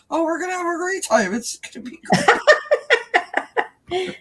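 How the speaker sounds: high, fast mock-cheerful fading to a whisper; sarcastic cheer. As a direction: chirp it sarcastically then drop it. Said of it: "I said to Deb".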